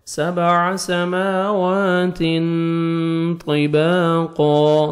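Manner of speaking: سَمَاوَاتٍ طِبَاقًا slow with the nun sound taken into the nose; slow tajweed drill; nasal ikhfa. Shown as a demonstration. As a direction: hide the نون through the nose before طِبَاقًا. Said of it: "نوز میں sound لے کے جانا ہے".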